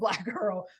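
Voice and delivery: ordinary talk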